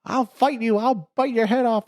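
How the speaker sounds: very high, loud cry; mock defiant knight. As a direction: yell it high and silly like a limbless knight. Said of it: "And he's like".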